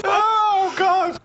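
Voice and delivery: high, shouted through laughter; laughing. Real, not acted. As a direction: cry out while laughing. Laughing at the story.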